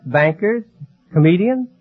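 ordinary talk, nothing marked

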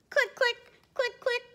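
very high rapid clicks; camera clicks. A narrator's reading. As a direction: rattle off shutter clicks. Camera sound effects.

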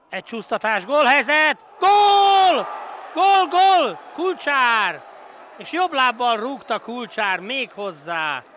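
screaming goal call, high and loud; goal shout. Real, not acted. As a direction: yell the goal with pure joy. Goal commentary.

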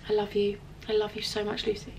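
soft, heartfelt thanks to a best friend; tender affection. Real, not acted. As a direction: say it quietly, overcome with love. Overcome, thanking her best friend.

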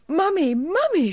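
high, loud call to mother; a child's high, eager shout; excited cry. A narrator's reading. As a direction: call out for Mummy in delight. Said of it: "she cried".

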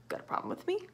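breathy ghostly whisper. Acted. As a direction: whisper it like a threat. Menacing ghostly question.

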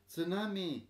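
high, loud alarmed cry; shrieked. Acted. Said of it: "shrieked the people".

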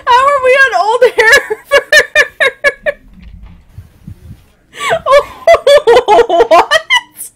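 high, loud, laughing in disbelief; squealing with laughter, near tears; shrieking laughter. Real, not acted. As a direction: cackle it out, can't believe it. Still losing it over the map.

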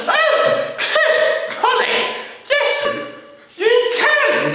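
high, gushing mock delight; hammed-up thrilled reaction; delighted amazement. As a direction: exclaim each word as if bowled over. Agent's delighted reply acted out.

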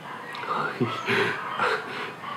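soft unvoiced giggle; breathy laugh. Real, not acted. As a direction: giggle with barely held excitement. Eager to eat the sandwich.

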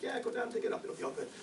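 breezy high-pitched mock reassurance; careless brush-off. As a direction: toss it off like it's no big deal. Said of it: "And it's like".

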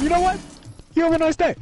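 high, loud called-out repeat; repeated yell across room. Shown as a demonstration. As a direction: shout it again across the room. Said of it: "Just yelling across the room".

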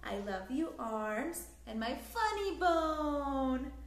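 plain reading voice

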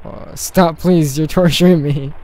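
loud, playful begging; anguished pleading. Acted. Hungry Wilma begs Leos to stop, loud pleading. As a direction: beg as if the food talk hurts.

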